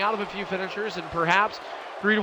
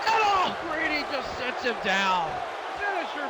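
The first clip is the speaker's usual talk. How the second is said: excited shout; 6 semitones higher than usual. Real, not acted. Commentator shouting at big spot.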